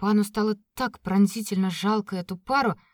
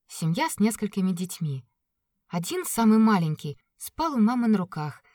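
usual speaking voice

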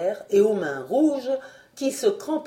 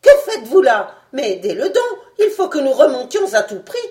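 loud, higher, pressing command; impatient, forceful raised voice; urgent shouted orders; 4 semitones higher, 8 dB louder, faster than usual. A narrator's reading. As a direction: shout at them to help, quickening. Doctor orders narrator to help pump.

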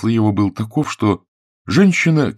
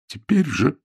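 higher, faltering start; voice trembling; 9 semitones higher than usual. A narrator's reading. Woman turns to her disappointment, voice trembling. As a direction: falter as the hurt comes up. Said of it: "голос её задрожал".

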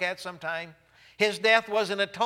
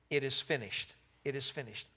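low, fading near-murmur; hushed dying words; 6 semitones lower, 8 dB quieter than usual. Acted. Jesus on the cross speaking his last words, hushed and fading. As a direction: let the words die away softly.

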